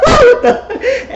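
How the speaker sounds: very high, loud disbelieving cry with a laugh; shocked shriek. Real, not acted. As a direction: scream in shock at the chaos. Shocked as fight breaks out.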